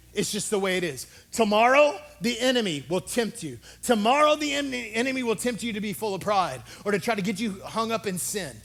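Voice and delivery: high, loud, fervent preaching shout; full-force preaching. Acted. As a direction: preach it at the top of your lungs.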